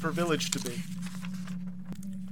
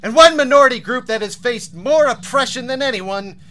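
story narration. Acted.

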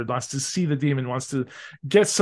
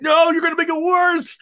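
high, loud exasperated warning; high loud pleading at the character; exasperated yelling; 14 semitones higher, 5 dB louder than usual. Retold or imitated. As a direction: yell at the screen. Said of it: "I'm like".

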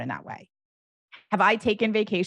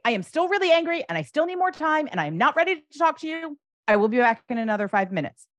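higher, louder and faster, openly fed up; still angry, firm announcement; 9 semitones higher, 4 dB louder, faster than usual. Shown as a demonstration. Parent modeling anger for a child, a loud, fast still-angry announcement. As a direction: declare you're still too angry to talk. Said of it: "you come back in five minutes and you say".

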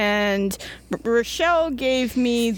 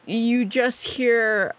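normal talking voice

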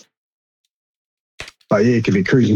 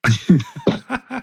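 laughing; 7 semitones higher, 3 dB quieter than usual. Pretend. Mock conspiracy warning, laughing.

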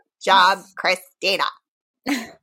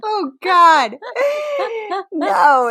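laughing helplessly; 10 semitones higher, much slower than usual. Real, not acted. Laughing hard at dumb lyrics.

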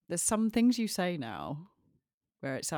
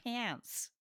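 high, soft imitation of American accent; attempted American accent; 7 semitones higher, 6 dB quieter than usual. Shown as a demonstration. British co-host trying host's accent, attempted American accent. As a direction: try out an exaggerated American vowel. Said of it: "I'm gonna learn your accent".

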